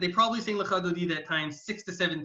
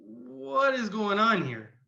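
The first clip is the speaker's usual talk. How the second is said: baffled disbelief; 5 semitones higher, much slower than usual. Retold or imitated. Host stunned by his guest's real name, slow baffled disbelief. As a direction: stretch it out in astonishment.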